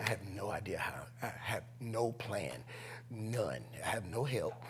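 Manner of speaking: soft, low frightened admission; hushed fear. Retold or imitated. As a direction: hush it out in dread. Kid scared, no plan.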